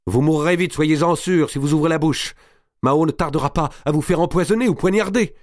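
high, loud, urgent threat; vehement threat. A narrator's reading. Madame de Bouville menace Marie. (menace véhémente)

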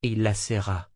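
ordinary delivery

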